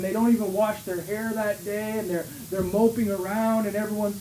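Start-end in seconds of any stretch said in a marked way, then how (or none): none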